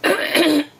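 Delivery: second throat clear. Real, not acted. Frog in throat, clears again.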